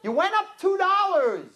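high, indignant mock protest; haggling customer. Second customer challenges the florist's price, high mock indignation. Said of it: "the other one's like".

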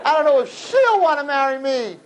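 mock whiny groom; whiny doubting boy. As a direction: whine it like the unsure groom.

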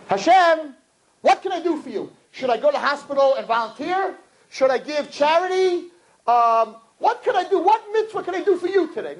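high, eager volunteering voice; eager offering. Acted. Worshipper offering God help in morning prayer, exaggeratedly eager. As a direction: pitch it high, earnestly keen to serve.

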